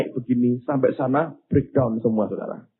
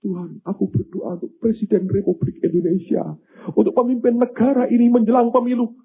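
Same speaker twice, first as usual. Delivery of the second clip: high-pitched mock prayer; raised, pleading prayer voice, imitated; imitated intercessor's prayer; 9 semitones higher than usual. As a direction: imitate someone praying earnestly aloud. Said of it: "Dia berdoa".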